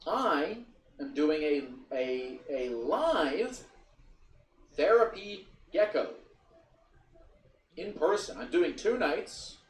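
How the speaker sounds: high, loud show announcement; announcer. Real, not acted. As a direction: proclaim the live show in slow, separated phrases.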